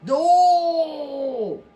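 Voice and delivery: long, high, loud "no"; drawn-out shout of protest; mock anguished cry. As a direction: wail it out in exaggerated dismay. Mock despair at a chat message.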